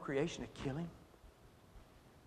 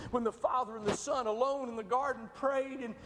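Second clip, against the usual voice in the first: rising preaching; 9 semitones higher than usual. Acted. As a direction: voice climbs higher, slowing, pleading. Preacher builds toward climax.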